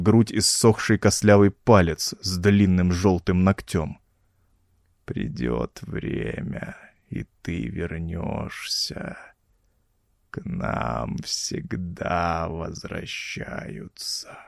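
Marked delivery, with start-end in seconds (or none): 5.0-14.4 put-on deep, slow, hoarse whisper of an undead old man; rasping whisper. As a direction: wheeze it out like a corpse that still talks. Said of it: "прохрипел он".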